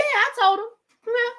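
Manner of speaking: usual speaking voice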